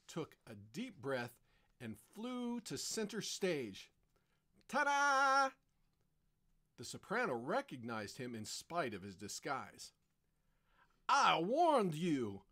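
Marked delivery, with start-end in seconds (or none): 4.7-5.5 high, loud flourish; triumphant entrance. Acted. Luigi presents himself centre stage. 11.1-12.4 loud, slow, raised-pitch roar. Acted. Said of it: "roared Baldini".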